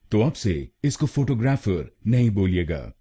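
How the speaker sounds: ordinary delivery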